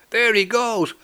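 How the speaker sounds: high, drawn-out excited cry; mock scream. A narrator's reading. Woman spotting cat at party, mock scream. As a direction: squeal it high without full volume. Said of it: "screamed a woman".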